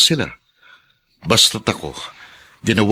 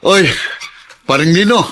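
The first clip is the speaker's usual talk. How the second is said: loud, high shout to a friend; calling out; 11 semitones higher, 5 dB louder than usual. Acted. Celso greets his old friend Nino, calling out. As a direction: hail him, voice raised high.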